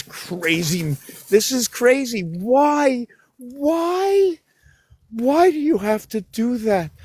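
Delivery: high, whiny exasperated pleading; exasperated whine. Acted. Scolding dogs pulling on leash.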